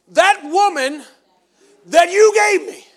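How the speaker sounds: high, loud, slowing on gave me; Adam's blaming whine. Acted. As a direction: point the finger, shifting the blame. Adam blaming God.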